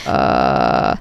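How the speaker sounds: very low subharmonic rumble. Shown as a demonstration. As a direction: drop into subharmonic voice. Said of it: "I'm going to use my subharmonic voice".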